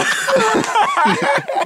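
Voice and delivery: sharp high burst of giggles; squealing laugh. Real, not acted. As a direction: crack up high. High laugh mid take.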